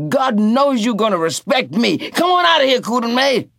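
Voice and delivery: loud, high furious order; Tea Cake angry. A narrator's reading. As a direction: throw the drunk out. Tea Cake throwing him out.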